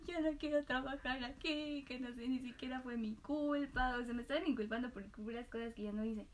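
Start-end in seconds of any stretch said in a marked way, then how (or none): 0.1-4.0 retold tearful complaint. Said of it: "corrí a llorar".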